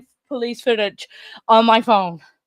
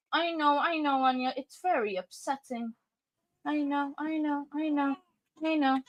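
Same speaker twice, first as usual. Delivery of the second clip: soothing; 11 dB quieter than usual. Real, not acted. Parent comforting upset Anya mid-vlog, soothing. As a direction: hush a fussing little one tenderly.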